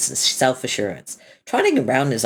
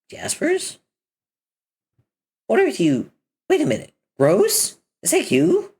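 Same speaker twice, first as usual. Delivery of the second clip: shocked; 10 semitones higher than usual. Acted. Shocked at transformed friend.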